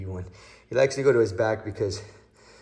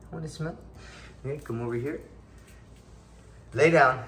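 ordinary talk, nothing marked

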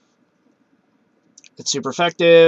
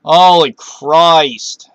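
real louder, slow drawn-out exclamation; stunned; 2 semitones higher, 9 dB louder, much slower than usual. Real, not acted. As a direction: let the shock stretch out. Shocked by a battle turn.

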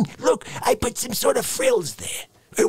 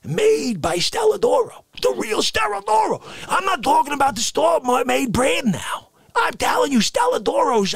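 high shout; 7 semitones higher than usual. Acted.

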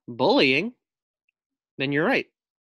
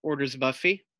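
put-on reading to children; 3 semitones higher, 5 dB louder than usual. A narrator's reading. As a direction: read it out lively to young pupils. Teacher reads picture book aloud.

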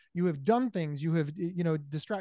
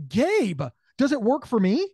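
loud curious friend; 5 semitones higher, 7 dB louder than usual. Retold or imitated. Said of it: "if you were like".

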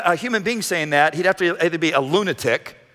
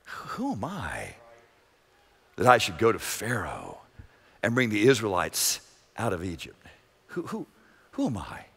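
much softer and lower, hardly above a murmur; hushed doubt; 9 semitones lower, 9 dB quieter than usual. Acted. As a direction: let Moses shrink into the question. Moses doubting himself before God.

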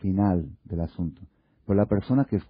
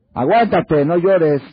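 higher, louder exasperated order; exasperated husband; 7 semitones higher, 8 dB louder, slower than usual. Retold or imitated. Husband telling crying wife to hold it in, exasperated. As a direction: snap it like a fed-up husband.

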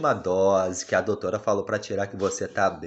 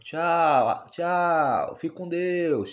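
dad saying bye for his daughter in a higher voice; a slow sing-song baby farewell; baby voice goodbye; 6 semitones higher, much slower than usual. Pretend. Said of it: "Fala assim".

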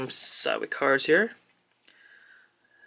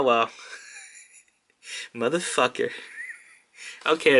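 ordinary talk

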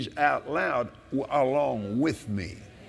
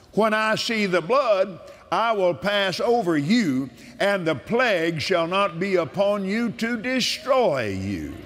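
preaching with force; 4 semitones higher than usual. Acted. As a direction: declare it like a sermon. Proclaims scripture.

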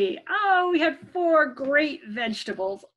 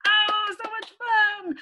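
excited; 8 semitones higher than usual. Real, not acted. After song with children.

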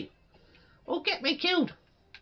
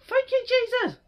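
usual speaking voice

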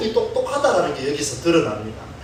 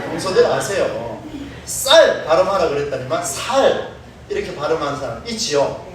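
usual speaking voice

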